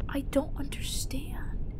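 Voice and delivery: slow, soft whispered confusion. Real, not acted. Player baffled by the game, slow whisper. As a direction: breathe it out, baffled.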